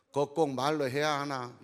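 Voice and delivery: usual speaking voice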